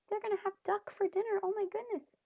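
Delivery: high, fast, worried exclaim; alarmed. Real, not acted. Reader warning children Ping will be eaten, high and alarmed.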